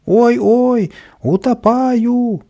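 very high, slow, loud drawn wail; hammed-up wail. Kapriska soaked by the hose, drawn-out comic wail. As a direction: wail it out as if drowning. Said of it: "вопил Каприска".

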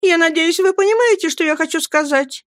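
put-on high, embarrassed young wife; flustered mother. A narrator's reading. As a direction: explain herself timidly in a high voice. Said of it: "сконфуженно опять заговорила она". (сконфуженно, высоким голосом)

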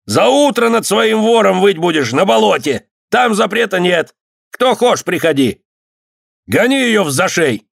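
high, ringing taunt and order; acted irate official; angry shouting. A narrator's reading. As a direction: drive her out with scorn. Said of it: "крикнул подьячий, сердясь".

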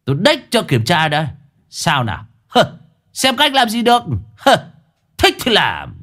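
cao giọng thách thức, hơi lớn; ném lại câu đanh thép rồi bỏ đi; defiant parting shot. A narrator's reading. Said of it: "bỏ lại một câu đanh thép". (thách thức khiêu khích, cao giọng)

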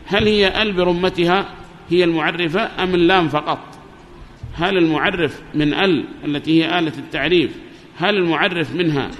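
ordinary talk, nothing marked

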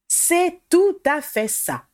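high, drawn-out burst of delight; delighted exclamation. A narrator's reading. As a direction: exclaim it slowly, thrilled to agree. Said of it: "s'exclama Ackerley, ravi".

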